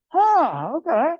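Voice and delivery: a high, loud "huh" of sudden discovery; mock aha moment, high and loud; sudden realization. Sudden realization, monster becomes ally.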